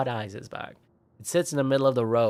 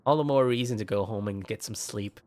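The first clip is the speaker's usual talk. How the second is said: ordinary delivery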